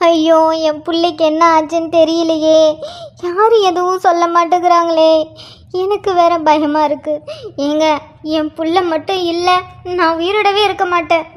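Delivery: low, keening wail of grief; lamenting wail. Acted. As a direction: mourn aloud for her son. Mother wails over injured son. (தாயின் ஒப்பாரி போன்ற அழுகை)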